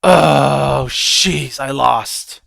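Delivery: loud exasperated groan over a loss; frustrated loser. Retold or imitated. A trader groaning loudly at himself after a loss. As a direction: blurt it out, annoyed at yourself. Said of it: "they go".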